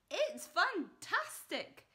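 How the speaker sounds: slow, high, loud cry; excited shout. A narrator's reading. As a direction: shout with delight. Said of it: "Mister Cranky shouted".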